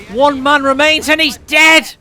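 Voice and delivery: loud, rising caster yell; excited shout. Acted. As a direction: yell it as the squad dies. Caster yells at wipe.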